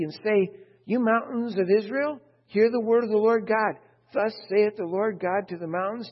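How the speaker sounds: scripture reading. Acted. Preacher reading Ezekiel to the congregation, loud proclaiming. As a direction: declaim the prophecy to the congregation.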